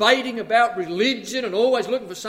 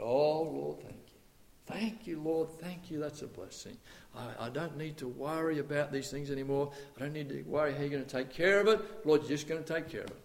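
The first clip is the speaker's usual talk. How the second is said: hushed thankful prayer; 5 semitones lower, 7 dB quieter than usual. Retold or imitated. Grateful prayer retold, hushed.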